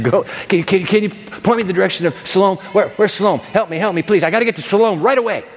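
frantic, hurried plea for directions; urgent pleading. Acted. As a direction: beg for help in a hurry. Acting blind man seeking the pool.

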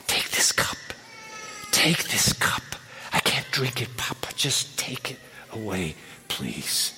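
soft whispered begging; begging prayer. Retold or imitated. Suffering listener begging God to take the cup, whispered plea. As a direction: plead quietly, nearly broken. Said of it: "You're begging God".

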